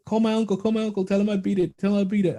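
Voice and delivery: put-on high, fast, excited child voice; a kid rushing to brag he won; eager nephew. Uncle retells his six-year-old nephew's excited demand, high and fast. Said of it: "he tells my mom, like".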